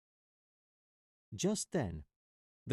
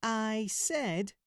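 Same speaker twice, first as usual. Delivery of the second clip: mock mouse shout; 11 semitones higher than usual. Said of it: "shouted the little mouse".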